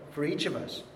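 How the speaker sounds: usual speaking voice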